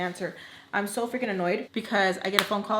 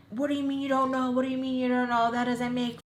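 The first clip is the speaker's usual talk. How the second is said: mock irate, higher-pitched rant; imitating livid stepfather; 4 semitones higher than usual. Stepfather grilling her about missing mom, imitated as livid. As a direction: mimic an exasperated man demanding answers. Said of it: "He is pissed. He is livid".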